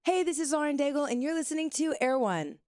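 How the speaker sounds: high, chirpy radio ID read; radio liner voice. Shown as a demonstration. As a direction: pitch it up like a squeaky station liner. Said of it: "I don't talk like this all the time".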